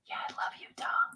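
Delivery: soft breathy whisper. Real, not acted. Whispering to the group about Utah. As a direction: breathe it out, barely voiced.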